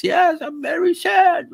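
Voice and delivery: shouted, very high, slow mock German accent; hammed-up German-accented whine; mock Klaus Schwab. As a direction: wail it in an overblown German accent. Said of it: "Il dit, ouais".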